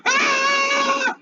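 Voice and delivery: high, loud panicked animal shriek; sudden high screech of fear; animal scream. Acted. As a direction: shriek in panic like a frightened beast. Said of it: "Princess is going".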